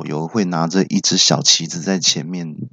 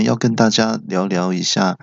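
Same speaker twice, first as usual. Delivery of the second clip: ordinary talk, nothing marked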